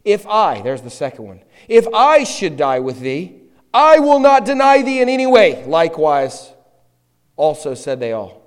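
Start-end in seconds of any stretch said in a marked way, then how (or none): 0.0-5.6 loud and high, swelling to a shout; vehement. Acted. Peter vowing to the Lord, vehement and loud. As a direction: insist fiercely, refusing to back down. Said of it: "he spoke the more vehemently".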